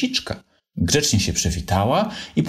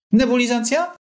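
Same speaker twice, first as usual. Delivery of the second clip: cheerful question. A narrator's reading. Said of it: "zapytali z uśmiechem".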